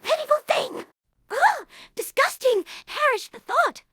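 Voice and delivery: high, indignant ugh and disgusting; Rarity offended at being called a self-harmer; appalled disgust. Acted. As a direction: recoil in affronted horror. Appalled at self-harm claim.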